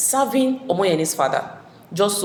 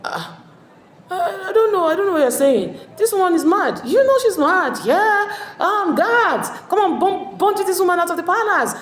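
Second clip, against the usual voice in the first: higher and louder, laughing and flustered; nervous blustering denial; 13 semitones higher, 5 dB louder than usual. Retold or imitated. Sherubamo brushing off Iyaba's accusation, flustered laughing bluster. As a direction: laugh her off in a panic, then call the guards. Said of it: "Sherubamo was like".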